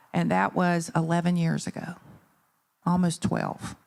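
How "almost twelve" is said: usual speaking voice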